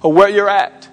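high, loud, ringing pulpit delivery; full-force preaching. Acted. Pastor preaching to the congregation, at full force. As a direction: preach it out to the whole room.

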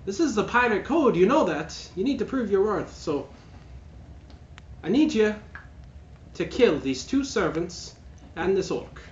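high, loud pirate captain sister; villainess sister. Acted. Sister demands brother prove loyalty by killing, high and commanding. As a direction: demand his loyalty with menace. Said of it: "And she's like".

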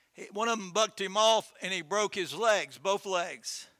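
ordinary delivery

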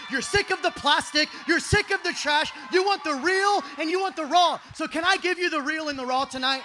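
loud, rhythmic build, pitch lifted; rally the crowd with punched, loud phrases; full-force preaching. Real, not acted.

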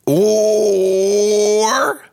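high, loud wailing Or. Real, not acted. Comedian's shrill wail into hell bit.

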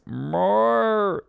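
high, long mock-animal roar; a playful dad's pretend pig noise, swooping up and down; Bandit's wild pig roar. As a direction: imitate a lilting beast roar.

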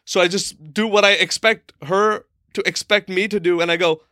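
plain reading voice

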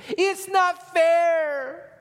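put-on very high, loud, very slow kid wail; drawn-out high child's protest; whiny kid. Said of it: "No one will be able to say".

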